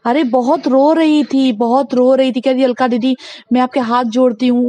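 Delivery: ordinary talk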